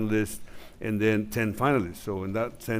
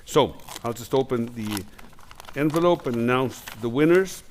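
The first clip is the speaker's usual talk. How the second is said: ordinary talk, nothing marked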